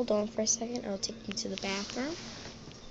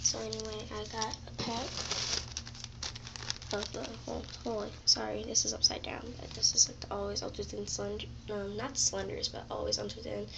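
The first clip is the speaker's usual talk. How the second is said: hushed, softly whispered so mom sleeps; quiet at night; 6 dB quieter than usual. Real, not acted. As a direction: keep it down, mom's asleep. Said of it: "I have to talk quiet 'cause my mom's sleeping".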